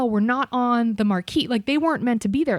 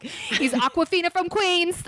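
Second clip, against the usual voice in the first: put-on slow, laughing, high Queens accent; drawn-out Queens voice, half laughing; 9 semitones higher than usual. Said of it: "Okay, this is him".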